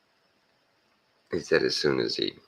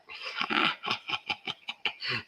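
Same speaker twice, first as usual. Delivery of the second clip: high, quiet wheezy laugh; acted snickering fit; imitated laughter; 10 semitones higher, 6 dB quieter than usual. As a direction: imitate someone bursting into laughter. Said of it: "he starts laughing".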